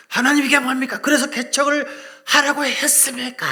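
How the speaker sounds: loud, high mock cry of frustration; hammed-up yell at heaven; shouting complaint to God. Pastor venting to God alone at the sea, loud cry. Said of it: "하나님 앞에 하소연하는 거죠".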